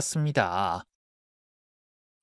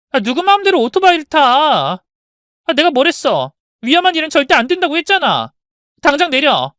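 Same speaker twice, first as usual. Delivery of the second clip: loud, higher angry nagging; angry scolding; 10 semitones higher, 8 dB louder than usual. Acted. Daughter scolding her father on the bike, loud and angry. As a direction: scold him off the motorbike.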